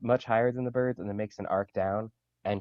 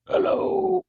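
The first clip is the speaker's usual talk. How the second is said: squeaky high little owl; 8 dB louder than usual. Acted. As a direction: give the owl a tiny high voice. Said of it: "do I give it a voice".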